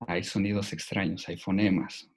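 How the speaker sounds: ordinary talk, nothing marked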